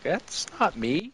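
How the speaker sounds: high, slow disbelieving reaction; mock disbelief. Hearing your own recorded voice, mock disbelief. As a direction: draw it out as if appalled by a recording.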